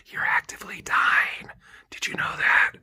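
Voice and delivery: mock whisper straight into the ear; sarcastic breathless whisper. Mocking the chaplain by whispering to mom. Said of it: "Why don't you go whisper it in her ear".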